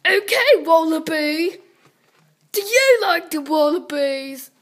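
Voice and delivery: loud put-on Australian accent. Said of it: "talking in an Au-, Australian accent".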